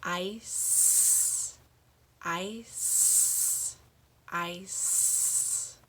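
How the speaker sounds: ICE with its S held long, each time; slow drill, draw out the end of each ice; long final S. Shown as a demonstration. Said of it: "let's say that one three times".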